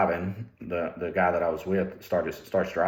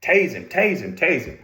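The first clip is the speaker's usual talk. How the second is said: high, loud imitated yell of a panicking trooper; mock frantic scream for the taser; retold screaming; 10 semitones higher, 6 dB louder than usual. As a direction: shout it like a partner mid-fight. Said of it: "the other trooper's screaming at me".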